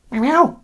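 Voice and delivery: very high little cat meows; imitated meow. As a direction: mimic a cat mewing.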